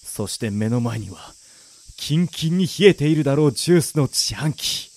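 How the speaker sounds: low, subdued inner narration; luckless man's monologue. Acted. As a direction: narrate your own misery, parched and worn out. Down-on-his-luck hero's monologue. (疲れた男の低い独白)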